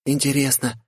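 put-on high, wondering girl voice. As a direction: muse aloud as a young girl. Gloria wondering aloud. (высокий девичий голос)

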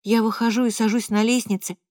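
ordinary delivery

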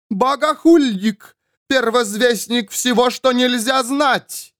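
high, slow, ringing accusation; denouncing nun. A narrator's reading. Nun condemns the false prophet, denouncing. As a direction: proclaim it like a zealous accuser.

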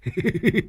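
guffaw. Acted. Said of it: "Lâm Sẹo nó cười hô hố".

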